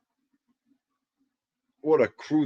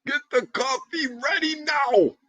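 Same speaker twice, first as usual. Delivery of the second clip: very high, slowed cartoon squeak; hammed-up squeaky order, stretched out; Mike's squeaky voice; slower than usual. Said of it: "I'm gonna get his voice".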